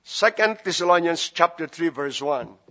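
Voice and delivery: usual speaking voice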